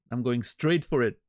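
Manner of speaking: ordinary delivery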